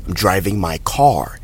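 American English, final R of car clearly heard; American accent. Shown as a demonstration. Teacher demonstrating a sentence, American accent. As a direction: sound the R in car, American style. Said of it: "in the American accent".

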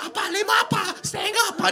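very high mock sobbing cry; overacted wailing. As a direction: wail over the father's body, hammed up. Wailing over father's body.

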